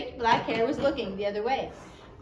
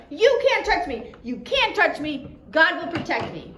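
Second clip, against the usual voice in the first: high, loud desperate cry; screamed; 6 semitones higher, 5 dB louder than usual. A narrator's reading. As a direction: scream it out in terror, holding him off. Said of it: "jumped backwards and screamed".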